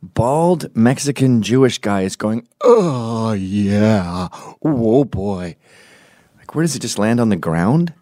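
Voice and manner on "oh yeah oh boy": drawn-out groaning imitation; mock pleasure moan. Mimicking the bald guy while others watch, mock pleasure moan. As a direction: moan it as a comic impression. Voicing the man pleasuring himself.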